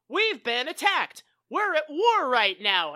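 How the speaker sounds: hammed-up bystander alarm; retold alarm.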